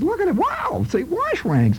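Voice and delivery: high, mock-amazed father; father getting excited. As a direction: gush over the rags in a silly high voice.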